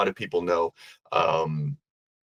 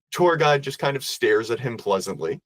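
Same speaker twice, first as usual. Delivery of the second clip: ordinary delivery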